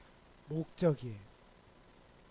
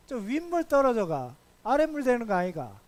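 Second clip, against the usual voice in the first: higher-pitched Gyeongsang dialect, acted as the friend; 4 semitones higher, 4 dB louder than usual. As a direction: put on a lifelike Gyeongsang accent with swinging intonation. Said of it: "경상도 사투리로 실감나게 얘기해달라고". (실감나는 경상도 사투리)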